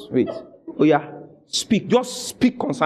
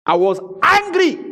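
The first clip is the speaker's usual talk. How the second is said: much higher, punched ANGRY; 10 semitones higher than usual. Retold or imitated. Pastor retelling his stand, emphatically angry. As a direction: hit ANGRY high and hard, retelling it.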